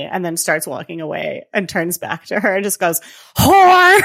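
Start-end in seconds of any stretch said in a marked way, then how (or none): 3.4-4.0 high, loud imitated shout; mock insult yell. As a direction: hurl it out suddenly, hammed-up. Quotes the man's sudden insult.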